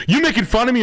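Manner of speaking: mock builder. Builder asking if he's being mocked, high and offended. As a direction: play him hurt and high. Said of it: "He's like".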